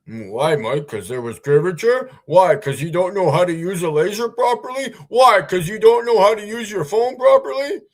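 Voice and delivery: rising into high, loud sneering questions; mocking critics. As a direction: parody the nagging critics.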